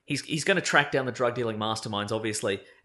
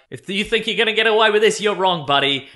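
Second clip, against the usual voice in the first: 2 loud, high hero threat; 3 call it out like a comic-book hero; 4 mock heroic warning, raised and loud; 6 semitones higher, 6 dB louder than usual. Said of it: "Aquaman's like".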